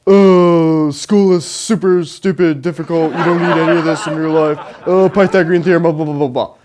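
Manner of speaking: lightly hammed-up griping student; a hint of whiny schoolkid griping; typical student complaint. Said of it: "the whole typical".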